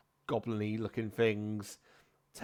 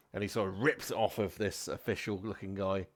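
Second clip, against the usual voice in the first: ordinary talk, nothing marked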